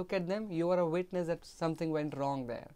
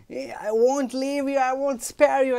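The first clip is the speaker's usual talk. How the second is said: high, loud angry maid; fighting maid; 8 semitones higher, 9 dB louder than usual. Acted. Maid threatening the other maid mid-fight, loud and high. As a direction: threaten her rival.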